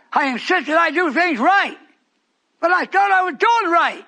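high, loud whiny threats; mocking accuser. Preacher mimicking the man who accused him, loud and whiny.

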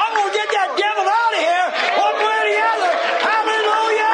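high, rapid shout of resolve; full-force preaching. Real, not acted. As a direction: build to a triumphant hallelujah. Preacher at climax of sermon.

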